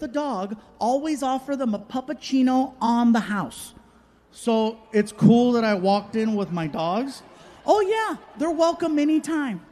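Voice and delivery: high, bubbly customer-service voice; chirpy barista. Retold or imitated. Comic telling of the barista welcoming his dogs, high and bubbly. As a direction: chirp it out sweetly.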